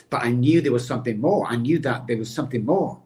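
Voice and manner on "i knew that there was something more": ordinary delivery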